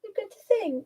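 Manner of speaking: high lisping pet voice; Alfie voice. Acted. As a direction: announce it as a small lisping animal. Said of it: "talk in the Alfie voice".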